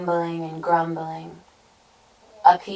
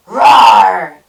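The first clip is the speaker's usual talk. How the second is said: loud, drawn-out roar; full-throated storybook monster roar; troll roar; 3 semitones higher, 16 dB louder than usual. A narrator's reading. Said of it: "roared the troll".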